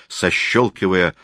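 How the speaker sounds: ordinary delivery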